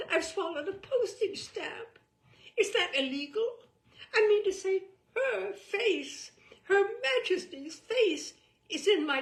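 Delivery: high, loud, hammed-up posh panic; blustering gent. Husband James flusters to Marianne about swallowing a stamp, loud and high.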